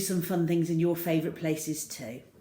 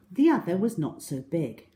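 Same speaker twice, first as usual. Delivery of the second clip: usual speaking voice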